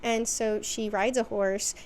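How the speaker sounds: ordinary talk, nothing marked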